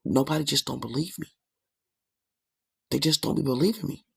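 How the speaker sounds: ordinary talk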